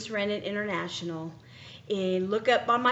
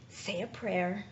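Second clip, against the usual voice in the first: calming herself; 11 dB quieter than usual. Retold or imitated.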